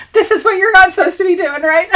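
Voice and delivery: breathless laughing aside. Real, not acted. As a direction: giggle at the mishap. Laughing on live stream.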